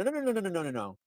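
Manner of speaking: panicked mock protest; frantic denial. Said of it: "has to go out in public and go".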